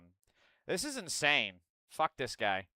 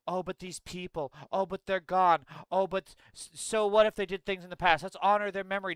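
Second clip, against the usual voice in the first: higher-pitched mock pleading; mocking mourners; 4 semitones higher than usual. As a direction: parody the excuse-makers in a raised voice. Mocks those mourning evil people.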